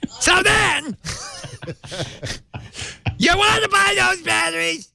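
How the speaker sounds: high shrill screeching squawk; Gilbert Gottfried impression.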